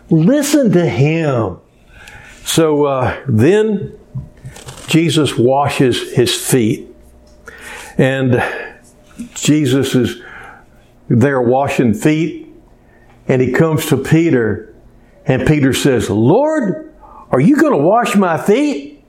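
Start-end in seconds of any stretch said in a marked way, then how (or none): 0.1-1.6 slow, drawn-out mild exasperation; weary insistence. Acted. God tired of listening to Peter, mildly exasperated. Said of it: "God's getting tired of listening to Peter". 16.1-19.0 high-pitched astonished question; acted incredulity. Peter asking Jesus about washing his feet, incredulous.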